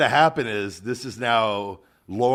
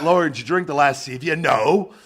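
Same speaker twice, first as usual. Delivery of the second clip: louder, higher acted exchange; hammed-up question, then emphatic denial; mock interrogation and denial; 4 semitones higher, 6 dB louder than usual. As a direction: act out a blurted, blatant little lie. Acted domestic question and lying denial.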